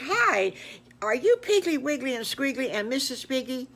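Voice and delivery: eager alligator greeting. Acted. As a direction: call out a bright friendly hi.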